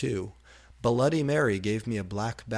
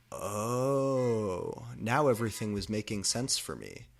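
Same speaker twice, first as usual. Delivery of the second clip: usual speaking voice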